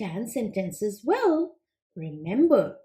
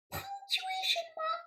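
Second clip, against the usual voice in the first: high, soft, baffled frog puppet repeating the word; puzzled puppet; 10 dB quieter than usual. Acted.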